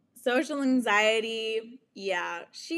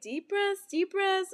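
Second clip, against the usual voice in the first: much higher, soothing mock friend voice; imitating friend calming; 10 semitones higher than usual. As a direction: coach her through breathing, lightly mimicked. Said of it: "And she's like".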